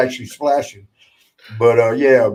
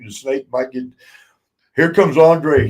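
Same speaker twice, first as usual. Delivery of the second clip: ordinary talk, nothing marked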